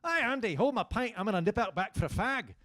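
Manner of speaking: breezy Scottish pub-goer; Scottish friend. Acted. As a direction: call to a mate in a Scottish accent. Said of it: "my friends would go".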